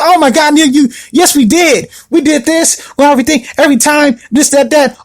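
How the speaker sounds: indignant insisting. Retold or imitated. Ex insisting they had sex.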